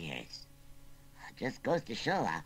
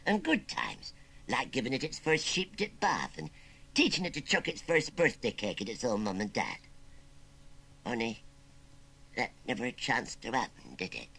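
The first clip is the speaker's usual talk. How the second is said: put-on understated wistful country old-timer; mournful; 3 semitones higher than usual. Old uncle imagining the child he never had, mournful. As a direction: reminisce with a touch of sorrow. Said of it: "went on Wurzel Gummidge mournfully".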